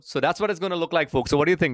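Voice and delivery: ordinary talk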